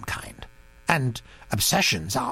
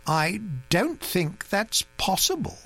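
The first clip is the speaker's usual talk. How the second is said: slowly; 7 semitones higher than usual. A narrator's reading. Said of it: "said Mister Satterthwaite slowly".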